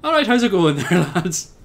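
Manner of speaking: a hint of Irish pub cheer; weird Irish accent. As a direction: greet the lads in a rough Irish accent. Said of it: "weird Irish accent".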